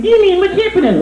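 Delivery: high squeaky rabbit puppet. Acted. Ventriloquist voicing his rabbit puppet, high puppet voice.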